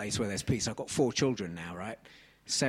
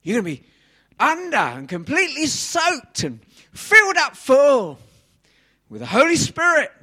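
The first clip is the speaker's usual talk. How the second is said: preaching at full force; 13 semitones higher, 14 dB louder than usual. Acted. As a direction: cry it out over the congregation. Preaching climax.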